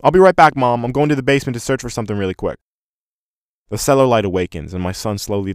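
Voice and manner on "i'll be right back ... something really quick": loud, high, quick youthful call. A narrator's reading. Son heading to the basement calling to Mom, loud and hurried. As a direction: call to Mom on the run, raised and fast. Boy calls out to his mother.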